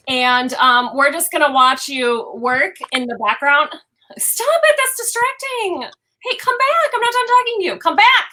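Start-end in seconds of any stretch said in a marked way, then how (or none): 4.2-8.2 heated family shouting. Real, not acted. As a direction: call him back across the room. Calling partner back to camera.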